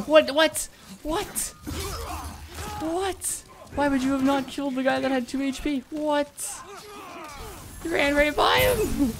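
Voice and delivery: can't believe what just happened; incredulous. Real, not acted. Teammate missed a kill.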